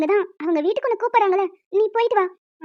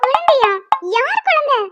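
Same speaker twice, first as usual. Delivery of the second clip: high, loud gasp of a question; floored by the news; shocked disbelief; 5 semitones higher, 3 dB louder, slower than usual. Acted. Woman shocked when told she's carrying his baby.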